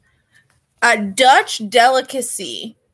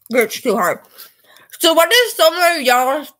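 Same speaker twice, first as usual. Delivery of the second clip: talking through a mouthful of food; words muffled by food in the mouth; mouth full; 4 semitones higher than usual. Real, not acted. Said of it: "I'm talking with my mouth full".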